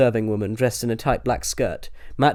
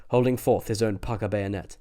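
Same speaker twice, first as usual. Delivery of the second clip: usual speaking voice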